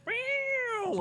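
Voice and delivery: high squealing guitar riff; guitar sound imitation. Electric guitar that goes.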